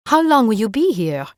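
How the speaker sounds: put-on light French accent, curious question. Rosalie asks Erin how long she stays, light French accent. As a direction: ask it lightly, with a French lilt. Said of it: "in a thick French accent".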